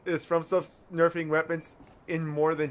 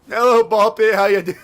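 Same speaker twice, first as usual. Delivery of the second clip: excited greeting; 6 semitones higher, 9 dB louder than usual. Real, not acted. Greeting a game character.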